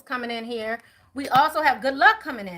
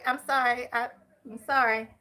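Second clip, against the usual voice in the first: ordinary talk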